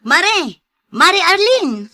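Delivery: loud, high call across the street; woman shouting to her friend Arlene. Acted. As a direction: hail her from a distance. Calling out to a friend.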